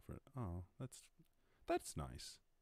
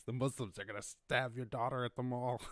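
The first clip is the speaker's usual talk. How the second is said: lightly voiced mock of the ranting singer; mocking quote; 5 semitones higher, 3 dB louder than usual. Streamer quoting the crazy song lyric, lightly mocking. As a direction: echo the singer's lyric with mockery.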